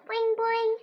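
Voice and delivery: high springy boing; frog boing. A narrator's reading. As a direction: twang it like a frog. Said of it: "he replied".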